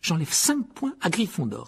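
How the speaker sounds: slow, high, curt sentence; ton cassant. Acted. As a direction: pronounce the penalty sharply, deliberately. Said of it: "répliqua Percy d'un ton cassant". (sanction martelée d'un ton cassant)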